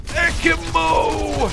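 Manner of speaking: high, long-held battle cry; triumphant call. Pretend. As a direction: stretch it out high like an announcement. Crows about double revolvers.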